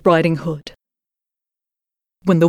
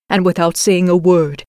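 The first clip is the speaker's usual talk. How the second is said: usual speaking voice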